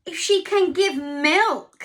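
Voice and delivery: a lightly voiced fretful cow. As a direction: cow worrying about her milk.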